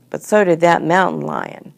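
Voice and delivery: ordinary delivery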